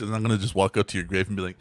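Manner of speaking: usual speaking voice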